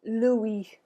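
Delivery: "Louis" said as loo-EE, no final S; French "Louis", ending on "ee" with a silent S; French pronunciation. Shown as a demonstration. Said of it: "repeat after me".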